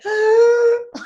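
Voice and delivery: a sharp, high, loud crying gasp; hammed-up gasp of a hurt friend; mock sobbing gasp. A hurt yellow blue hearing blunt feedback, mock sobbing gasp.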